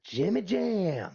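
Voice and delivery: high, drawn-out mock holler; imitating a distant caller, long and high; retold far-off call. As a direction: stretch it out high like calling from afar. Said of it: "from far away I hear".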